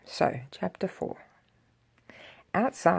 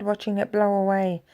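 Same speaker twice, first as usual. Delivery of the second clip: ordinary delivery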